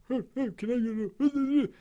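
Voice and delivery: high, mumbled order at a bar; mock a mumbler ordering; imitating a mumble. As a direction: friend mocks Jim mumbling his order. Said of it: "you don't speak the clearest".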